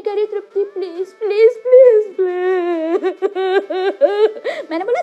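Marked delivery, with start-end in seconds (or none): 0.3-2.3 whiny fake sobbing please; mock crying plea. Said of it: "रोने लग गई मेरे सामने". 2.3-4.7 hammed-up blubbering laugh; a fake wail that breaks into laughter; half laugh, half mock cry; laughing sob.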